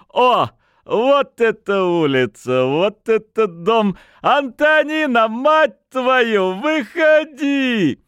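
high, loud, drawn-out yell; tipsy street holler; rowdy calling out. A narrator's reading. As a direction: bawl up at the house for her to come out. Tipsy lad calls woman out.

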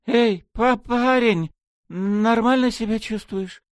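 high, agitated, stammering figure; bewildered. A narrator's reading. Tiger-masked man checking on his living victim, flustered and high. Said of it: "растерянно обратился ко мне тигр".

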